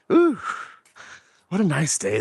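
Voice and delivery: normal talking voice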